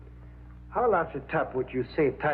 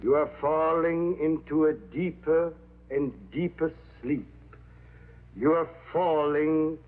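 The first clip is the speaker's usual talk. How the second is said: hypnotic induction; 3 dB quieter than usual. Acted.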